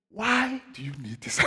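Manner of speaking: gently question, as God answering a prayer; hushed divine question. Retold or imitated. God's answer to retold prayer.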